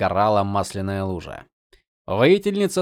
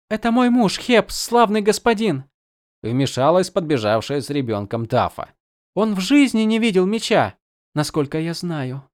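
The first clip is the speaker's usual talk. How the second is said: high-pitched worried village wife; anxious peasant woman; 7 semitones higher than usual. Acted. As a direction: plead nervously, voice pitched up. Taffa voiced by narrator. (встревоженная крестьянка, высоким голосом)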